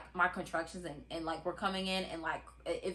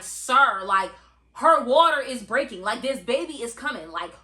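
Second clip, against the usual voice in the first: loud, higher, exasperated demand; retold angry outburst; 3 semitones higher, 7 dB louder than usual. Dad yelling at the security guard to open the door. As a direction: push the guard hard, voice raised. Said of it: "My dad is flipping out on this man".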